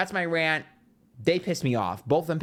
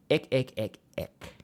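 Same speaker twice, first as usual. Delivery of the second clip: disgusted. Real, not acted. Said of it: "That just really disgusted me".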